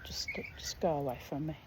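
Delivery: fast, soft whisper; whispered brush-off. Retold or imitated. Telling Steph to go away, in a fast whisper. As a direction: breathe it out quickly, wanting him gone.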